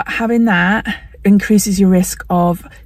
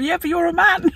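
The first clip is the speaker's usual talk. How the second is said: higher, softer teasing comeback; cheeky retort; 9 semitones higher, 4 dB quieter than usual. Retold or imitated. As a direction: tease the doctor with a quick comeback. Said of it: "I said to him".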